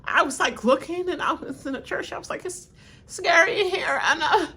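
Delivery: high, mock-dramatic Carolyn impression; imitating Carolyn. Retelling Carolyn's church story in a high mock voice. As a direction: play her as high and spooked. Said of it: "She like".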